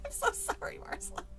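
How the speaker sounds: plain reading voice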